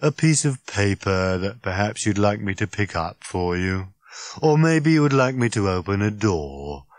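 glum, droning robot; hollow rattle. Acted. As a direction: offer help with dreary sarcasm. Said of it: "in a kind of hollow rattle".

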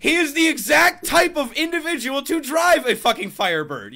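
high, very loud vindicated yell; triumphant shout. Retold or imitated. Worker hearing his car guess confirmed, triumphant shout. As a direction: shout it, thrilled to be proved right.

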